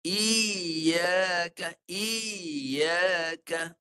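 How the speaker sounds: Iyaka with a wavy, undulating i; iyaka with a bouncing, rolling vowel instead of a clean rise; wavering i. Shown as a demonstration. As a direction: let the i in iyaka wobble up and down. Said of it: "dia berhenjut, dia beralun".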